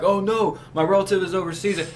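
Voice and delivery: mock worried relative. Voicing worried relatives in complaint.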